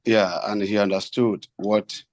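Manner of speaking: ordinary talk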